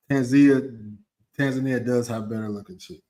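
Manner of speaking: ordinary talk, nothing marked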